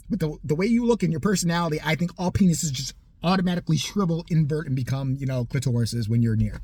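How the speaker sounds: ordinary talk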